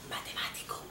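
whispered aside; whispered comment. Real, not acted. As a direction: breathe the word out. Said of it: "unvoiced whisper".

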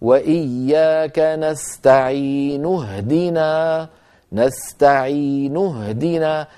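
نستعين joined straight onto اهدنا, full final u; slow Quran recitation linking نستعين into اهدنا; connected recitation. Shown as a demonstration. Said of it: "لو وصلتها أقول".

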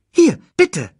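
high, quick cartoon meerkat; chirpy little sidekick voice. Timon offers grub.

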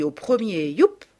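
ordinary delivery

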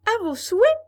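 high, loud little Pinocchio; perky high-pitched call; puppet boy voice. A narrator's reading. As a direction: pipe up politely in a high puppet voice.

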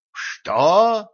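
shocked. A narrator's reading. Voice from radio.

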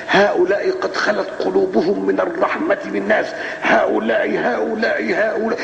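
high, slow, bombastic courtroom oratory; mock prosecutor speech. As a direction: ham up a pompous prosecutor's speech. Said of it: "يقول إيه بقى؟".

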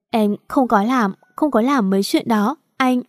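usual speaking voice

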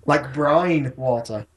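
brine drawn out with a long I; long I example. Shown as a demonstration. Podcaster proving his point, brine slow with long I. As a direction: slowly, stretch "brine" to rhyme with sign. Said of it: "it's not pronounced Ein".

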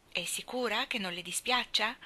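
ordinary delivery

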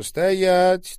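loud, high command to halt; shouted order. A narrator's reading. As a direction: stop them with one sharp shout. Armed man orders everyone to halt. (громкий окрик-приказ)

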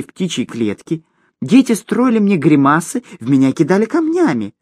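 high, whiny gnome voice; high self-pitying gnome plea; pleading gnome. Acted. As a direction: beg for mercy like a tiny gnome. Gnome pleading for his life.

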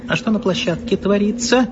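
high-pitched indignant question. A narrator's reading. Director demanding of the foreman, indignant. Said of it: "возмутился Львович".

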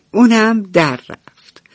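usual speaking voice

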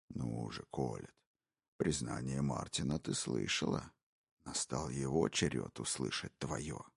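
low, hushed, gloating whisper; a predator savouring every word; menacing intruder. Acted. Intruder taunting the wife, low sinister whisper. Brogan's voice.

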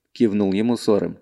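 ordinary delivery

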